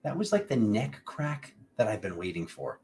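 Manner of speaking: usual speaking voice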